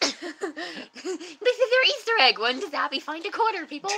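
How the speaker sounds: loud, slow, playful announcement; hammed-up excitement. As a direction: tease the audience loudly and slowly. Teasing viewers about Easter egg.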